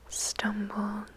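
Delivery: usual speaking voice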